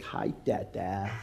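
put-on soft, slow, innocent toddler greeting; sweet little boy. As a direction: play the angelic child hiding his mischief. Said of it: "all sweet".

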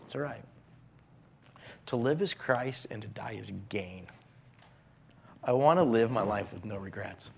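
a soft hand on the shoulder; gentle comfort. Acted. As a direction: console a struggling friend gently. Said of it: "pat them so gently and say".

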